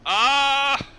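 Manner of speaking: sudden high, loud "Ah!"; loud cry. Real, not acted. Game master reacts to a player's request, loud high cry. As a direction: burst out with a high yelp.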